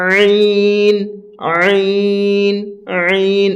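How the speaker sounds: আইন drawn out from a squeezed throat. Shown as a demonstration. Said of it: "আমাদেরকে বের করতে হবে এইভাবে". (গলা চেপে ভিতর থেকে আইন)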